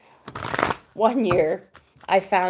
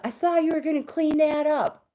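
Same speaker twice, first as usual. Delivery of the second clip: upset son; 8 semitones higher than usual. Retold or imitated. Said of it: "now he's getting upset".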